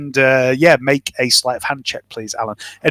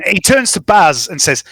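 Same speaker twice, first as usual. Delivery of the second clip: usual speaking voice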